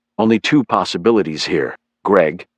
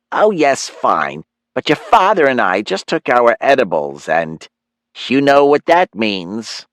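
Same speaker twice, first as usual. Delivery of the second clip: higher, lively mom voice; chatty mother; 4 semitones higher than usual. Acted. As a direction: play a confiding mom, pitched up. Narrator voices Myron's mother.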